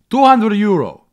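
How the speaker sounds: loud, high hawker price call; mock seller quoting the price; street seller. Seller names his price.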